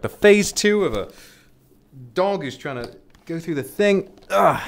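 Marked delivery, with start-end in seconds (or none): none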